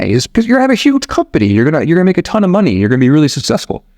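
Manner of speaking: high-pitched, fast sales pitch; eager American tourist. Acted. Tourist urging the goat farmer to grow, acted eagerness. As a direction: rush it out high, selling the big plan. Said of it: "And, and the American says".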